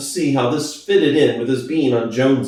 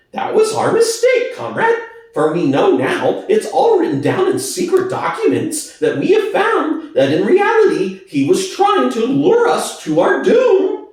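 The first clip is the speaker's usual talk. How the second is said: high, oily, wheedling pig voice; Squealer's high voice; 14 semitones higher than usual. Acted. Squealer to the animals, high and wheedling. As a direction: persuade the crowd, pitched way up and sly.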